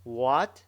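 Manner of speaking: loud "What?" sweeping upward; emphatic questioning rise on "What?"; rising second tone. Shown as a demonstration. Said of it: "Remember, remember it".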